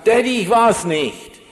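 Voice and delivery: re-enacted earnest, pleading denial; imitated sincere child's plea. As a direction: imitate a boy insisting on his innocence. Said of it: "das klang so echt".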